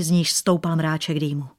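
ordinary delivery

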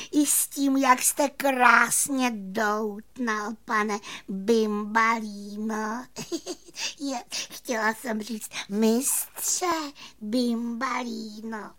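high, slow, drawn-out fairy praise; admiring little fairy. A narrator's reading. Fairy flattering the magician, high slow cooing with giggle. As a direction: gush slowly with a small giggle. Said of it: "zatleskala malá víla".

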